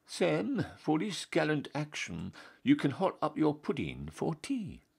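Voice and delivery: the Duke, full of glee, making a grand gift; gleeful Duke. Acted. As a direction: let the Duke beam with grand praise. Elated Duke rewards Sam.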